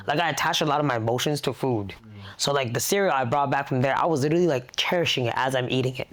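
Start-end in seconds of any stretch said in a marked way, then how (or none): none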